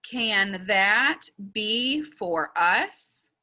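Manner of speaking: very slow, every word separated; counting out words. Shown as a demonstration. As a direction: lead learners slowly word by word. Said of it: "let's do it with me".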